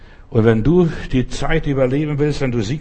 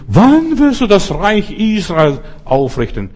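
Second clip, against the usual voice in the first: high, loud, slow questioning; 7 semitones higher, 5 dB louder, slower than usual. Retold or imitated. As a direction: drag out the eager disciples' demand, up and loud. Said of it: "kommen und sagen".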